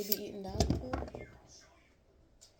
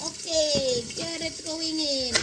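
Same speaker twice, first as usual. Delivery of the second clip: put-on higher, louder Italian accent; 5 semitones higher, 4 dB louder than usual. Cook dropping the carrot in, in an Italian accent. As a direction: announce it like a comic Italian cook. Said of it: "Forgive my Italian accent".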